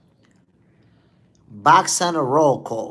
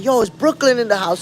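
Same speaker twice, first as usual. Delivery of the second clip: higher-pitched shout to a crowd; acted call-out; 6 semitones higher, 4 dB louder than usual. Traveler in Japan looking for Brooklyn folks, acted call-out. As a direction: act out hollering for your hometown. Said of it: "and you be like".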